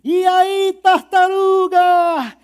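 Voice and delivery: loud, high, drawn-out call; hungry animals greeting the returning turtle; eager animals. Acted. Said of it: "os animais famintos e ansiosos".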